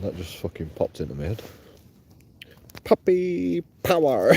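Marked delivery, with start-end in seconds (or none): 2.8-4.3 high, slow, triumphant cartoon puppy; Scrappy Doo impression. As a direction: shout it like a tiny heroic dog. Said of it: "doing a good impression of him".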